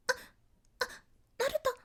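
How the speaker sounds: put-on high, startled, shy girl; Hinata voice. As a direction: stammer out the name shyly. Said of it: "a little bit startled".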